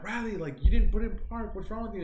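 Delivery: high-pitched exasperated reproach; acted-out frantic telling-off; retold scolding. Scolding Riley for not putting it in park, high exasperated voice.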